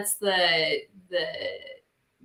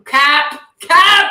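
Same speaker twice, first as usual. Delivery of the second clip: loud, high, punchy call-out; calling out cap; 8 semitones higher, 13 dB louder than usual. Real, not acted. Woman heckling a boastful claim, loud call-out. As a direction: shout it out to call the bluff.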